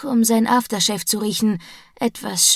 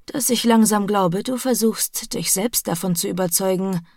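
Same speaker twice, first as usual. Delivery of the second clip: plain reading voice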